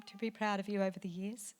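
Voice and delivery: usual speaking voice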